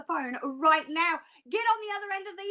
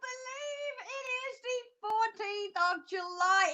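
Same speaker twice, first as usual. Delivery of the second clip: very high, squealed hello and welcome; ear-splitting excited greeting; 9 semitones higher than usual. Real, not acted. Host welcomes viewers on a long-awaited night, ear-splitting excitement. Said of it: "If your eardrums have just burst".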